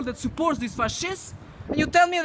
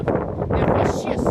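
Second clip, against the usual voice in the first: whisper; much slower than usual. Real, not acted. Ranting at the media.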